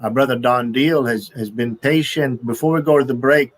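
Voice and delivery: normal talking voice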